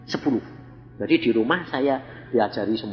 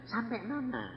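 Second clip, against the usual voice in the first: tanya pelan dan lirih; tanya malu-malu ke teman sebelah; bisik bingung; 9 semitones higher, 12 dB quieter than usual. Retold or imitated. Murid bingung bertanya pelan. (murid bertanya lirih ke teman sebelah)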